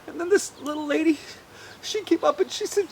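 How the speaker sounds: ordinary delivery, nothing marked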